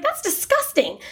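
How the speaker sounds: high, appalled exclamation; shocked mom. Retold or imitated. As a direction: recoil at the crude joke. Mom reacting to son's joke.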